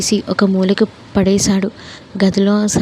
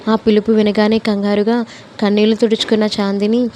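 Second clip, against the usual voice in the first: ordinary delivery, nothing marked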